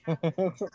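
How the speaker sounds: a laugh that ends in a snort. Real, not acted. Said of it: "Did you just snort?".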